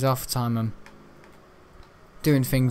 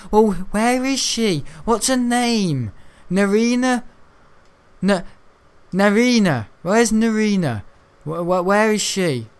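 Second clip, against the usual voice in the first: calling out; 6 semitones higher, 3 dB louder than usual. Real, not acted. Searching for the NPC.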